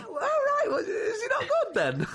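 high, slow, laughing mock innocence. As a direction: squeak it out through laughter. Plays innocent on the phone, laughing.

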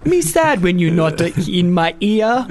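slow, needy broken-English caller impression; put-on pidgin voicemail voice; Larry's weird voicemail. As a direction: plead slowly like an odd voicemail caller. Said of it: "weird voicemails from Larry in Catford".

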